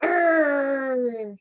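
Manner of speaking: "err" as one long, growly vowel; phonics sound. Shown as a demonstration. Teacher showing what I and R say, drawn-out "err". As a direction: hold "err" out like a bear's growl. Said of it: "What does I R say?".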